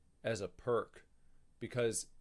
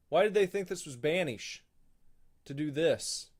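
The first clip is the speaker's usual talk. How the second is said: usual speaking voice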